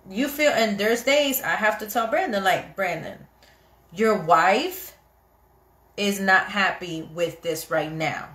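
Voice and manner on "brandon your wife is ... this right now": slow, deliberate retold statement; intentional and literal. Wife telling husband she is unhappy, intentional and literal. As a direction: spell it out slowly and plainly. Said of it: "intentional and literal".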